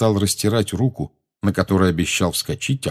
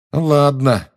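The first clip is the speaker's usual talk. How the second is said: stung, pitch raised; higher, resentful tone; hurt, offended; 7 semitones higher, 4 dB louder than usual. A narrator's reading. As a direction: take offence, voice ringing with it. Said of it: "в голосе Джеймса зазвенела обида".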